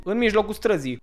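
usual speaking voice

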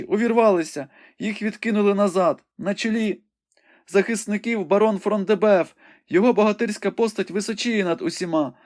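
high, urgent maiden reporting a battle; young woman Rebecca, breathless and excited. A narrator's reading. Rebecca calls the battle out to the wounded Ivanhoe, high and urgent. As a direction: describe the fight in a high, eager voice.

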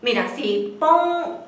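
normal talking voice

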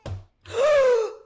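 loud high gasp of shock. Real, not acted. Gamer seeing the demon baby, gasping.

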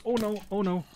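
high panicked oh no, over and over. Real, not acted. Gamer panicking as the skeleton archer appears.

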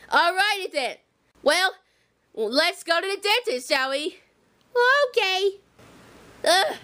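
low, slow scolding grown-up voice; deep motherly warning; Harriet voice. Pretend. Harriet voice again.

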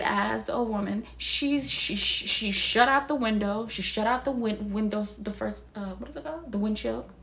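ordinary talk, nothing marked

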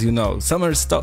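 ordinary delivery

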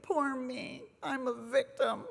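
imitating her own pitiful moaning; mock self-pity. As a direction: whimper like a sorry victim. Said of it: "I was like".

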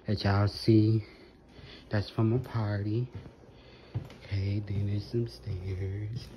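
soft, half-whispered, voice nearly gone; quiet excited whisper about the big day; hushed lost voice. Real, not acted. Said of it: "I'm talking so calm because I believe that I lost my voice".

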